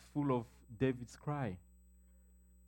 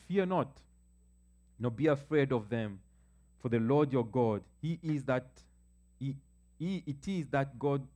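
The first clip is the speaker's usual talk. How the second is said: scripture proclaimed; 3 semitones higher, 6 dB louder than usual. Acted. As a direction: call the verses out to the congregation with full force. Preacher proclaims Joshua scripture aloud.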